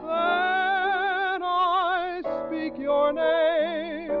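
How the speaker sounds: ordinary talk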